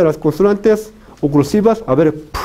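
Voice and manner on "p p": "p" as a bare stop burst; voiceless "p", each one separate; plosive demonstration. Shown as a demonstration. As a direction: close fully, then pop the "p". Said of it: "hay una oclusión total".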